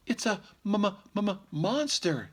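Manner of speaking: high, slow stammering fright; scared stutter. Acted. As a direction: stutter with fear like a mother bear. Missus Blair stammers in story.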